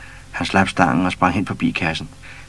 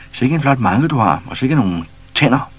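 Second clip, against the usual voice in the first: high, loud mock admiration; cheeky flattery; 6 semitones higher, 6 dB louder than usual. A narrator's reading. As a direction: tease him to his face. Pus teasing lion.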